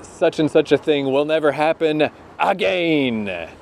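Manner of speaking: light Winnipeg drawl on "again"; a hint of a Winnipeg catchphrase; imitating Winnipeg accent. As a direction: mimic the wrestler's Winnipeg "again". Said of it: "he'd always say".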